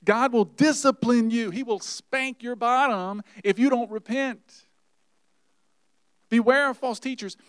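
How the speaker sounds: high, loud hellfire warning; preacher warning the congregation of judgment; fiery preaching. Acted. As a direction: thunder it from the pulpit.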